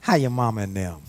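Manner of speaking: a light mock Southern accent; Southern accent, lightly done for a laugh; really Southern. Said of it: "here's how you know you're really Southern".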